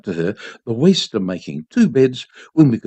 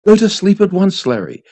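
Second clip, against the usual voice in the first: loud, higher sharp command; 6 semitones higher, 4 dB louder than usual. A narrator's reading. Mother ordering her son to sleep, sharply. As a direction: cut him off firmly. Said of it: "she said sharply".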